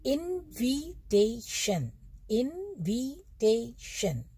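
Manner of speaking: इनविटेशन very slowly, in four separate stretched syllables; teacher's drawn-out model of इनविटेशन; sharply articulated syllables. Shown as a demonstration. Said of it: "बिल्कुल sharpen से होते हैं".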